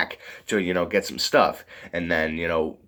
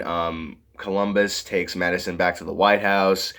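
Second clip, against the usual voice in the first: usual speaking voice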